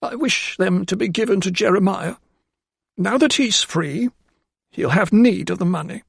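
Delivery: high, firmer housekeeper voice, slowing on the middle phrase; a remorseful maid finding resolve; housekeeper gaining strength. A narrator's reading. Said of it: "her voice suddenly gaining strength".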